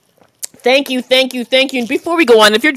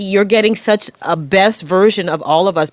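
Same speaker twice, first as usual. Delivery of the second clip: ordinary talk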